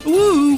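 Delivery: high excited duck whoop; duck noise woo. Acted. Excited DuckTales cheer.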